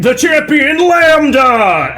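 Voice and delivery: high, slow announcer shout. Acted. Scary Joe presents the champion to the crowd, drawn-out announcer voice. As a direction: stretch out the champion's name for the arena. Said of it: "put on my announcer voice".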